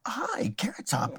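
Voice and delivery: soft breathy whisper, slow; whispered greeting. Retold or imitated. Best friend greets Carrot Top in a slow whisper. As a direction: breathe the name out slowly. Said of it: "and she says".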